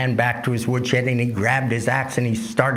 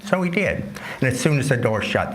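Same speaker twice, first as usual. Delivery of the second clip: ordinary delivery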